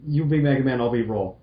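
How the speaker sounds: usual speaking voice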